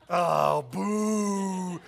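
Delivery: long, drawn-out playful boo; mock booing. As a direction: jeer your own joke like a crowd. Mock booing after his joke.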